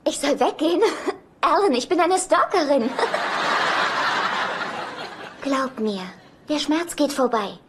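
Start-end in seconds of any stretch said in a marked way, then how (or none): 2.9-5.4 a long, breathy mocking laugh. Ex laughing at Alan, a long mocking laugh. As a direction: laugh at him, savouring it.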